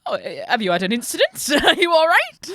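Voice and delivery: loud, quick start, pitched high by the end; awkwardly polite Brit. Acted. Brit avoiding the actual thing, awkwardly polite impression. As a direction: skirt round the obvious, over-politely. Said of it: "You see something and go".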